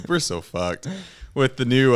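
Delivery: ordinary talk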